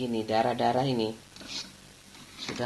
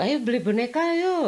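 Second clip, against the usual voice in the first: mother coaxing; 10 semitones higher than usual. Retold or imitated.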